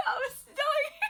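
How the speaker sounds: high, loud laugh-crying. Retold or imitated. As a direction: squeal through laughter. Retelling choking, laughing.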